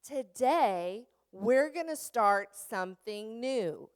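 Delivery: teacher unveiling something new; upbeat announcement. Shown as a demonstration. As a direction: build anticipation for the class. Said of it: "You can walk in and say".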